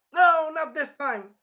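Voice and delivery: high, loud, drawn-out refusal; snapping defiance. Retold or imitated. Scared girl finally snapping at the monster, defiant shout. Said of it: "she's, like, snapping".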